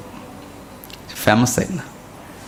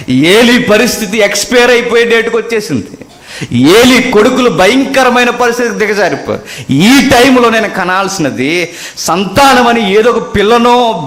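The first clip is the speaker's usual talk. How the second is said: high, loud pulpit cry; soaring sermon voice; fervent preaching; 8 semitones higher, 4 dB louder than usual. Acted. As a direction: preach it out with full force. Preacher at full force.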